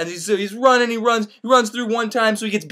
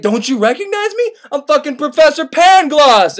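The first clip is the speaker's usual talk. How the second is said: high, loud mock-indignant reveal; indignant outburst; 7 semitones higher, 6 dB louder than usual. Pangloss to Candide in street, indignant outburst. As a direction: cry out, offended at not being known. Diseased man indignantly names himself.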